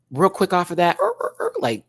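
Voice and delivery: normal talking voice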